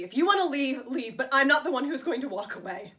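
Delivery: loud, forceful challenge; loud defiance. Acted. Wife daring George to leave while she stays, loud defiance. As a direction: stand your ground at full voice.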